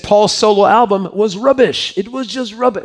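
put-on terrible English accent. Preacher quoting a fed-up bandmate to the congregation, bad English accent. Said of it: "That's a terrible English accent".

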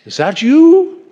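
put-on high, loud English-accented question; old nobleman calling out in an English accent. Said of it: "He didn't say it with an English accent".

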